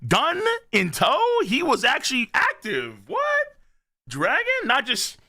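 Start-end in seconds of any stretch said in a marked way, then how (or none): 3.1-3.5 sudden high squeak of disbelief; stunned what. Real, not acted. Reactor stunned at young Dragon sprinting, high surprised squeak. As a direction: jolt up in real shock.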